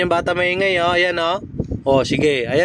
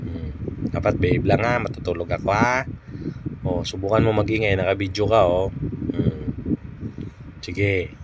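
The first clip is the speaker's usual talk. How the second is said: softer, lower murmured teasing; hushed teasing; 8 semitones lower, 6 dB quieter than usual. Real, not acted. Mother quietly teasing the noisy baby at bedtime. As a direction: keep it hushed like someone trying to sleep.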